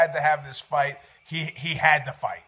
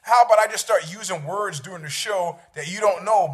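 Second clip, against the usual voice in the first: ordinary talk, nothing marked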